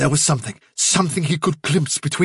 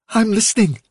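Fiver voice; 8 semitones higher than usual. Acted. Fiver's voice, higher.

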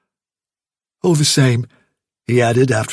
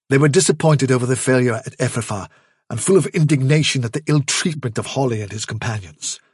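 ordinary delivery

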